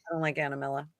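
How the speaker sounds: low, quiet imitation of someone upset; imitated upset grumble. Greg upset when Anna Miller shows up, low imitated grumble. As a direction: voice his complaint softly and low. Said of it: "Greg gets really upset".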